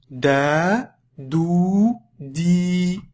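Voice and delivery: "Da, dou, di" with each vowel sustained; long vowels. Shown as a demonstration. Teacher repeating long vowels for a learner, "Da, dou, di" held long. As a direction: draw out every vowel in "Da, dou, di". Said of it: "on passe aux voyelles longues".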